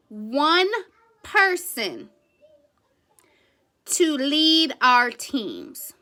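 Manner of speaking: slow emphatic question. Acted. Pointed slow rhetorical question.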